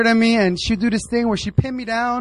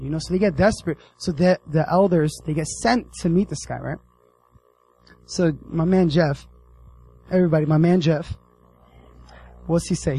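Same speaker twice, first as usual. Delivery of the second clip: ordinary delivery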